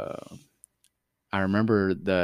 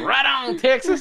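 loud, high whoop for Texas; excited cheer; 7 dB louder, slower than usual. Retold or imitated. Cheering on the Texan's answer.

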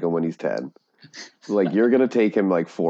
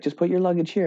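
high, bubbly theme-park greeter voice; cheery cast member; 10 semitones higher than usual. Retold or imitated. Retold Disney worker greeting guests brightly. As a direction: sell the magic, sweet and high. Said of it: "She's like".

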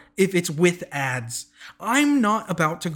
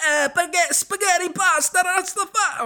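much higher hammed-up Italian accent; mock Italian; 14 semitones higher than usual. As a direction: ham up a cartoon Italian accent. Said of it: "the most Italian name on the planet".

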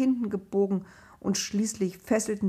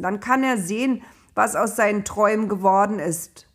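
angry plotting; 3 semitones higher, 7 dB louder than usual. Acted. Brothers plotting against Josef.

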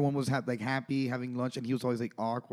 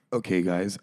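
ordinary delivery

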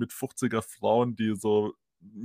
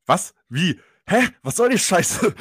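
higher, louder incredulous yell; baffled outburst; 8 semitones higher, 9 dB louder than usual. Retold or imitated. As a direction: shout in total disbelief. Bouncer baffled as police leave.

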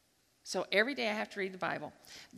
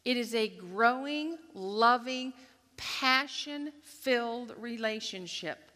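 ordinary delivery

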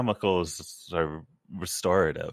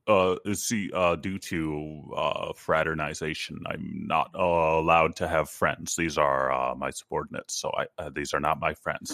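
lower, fumbling captain's excuse; flustered Picard; 6 semitones lower than usual. Retold or imitated. Picard denying his crew are friends, low and flustered. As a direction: stammer it out like an embarrassed captain.

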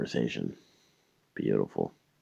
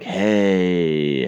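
mock-friendly greeting; 3 semitones higher, 6 dB louder than usual. Answering her call with false cheer.

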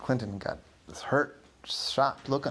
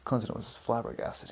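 normal talking voice

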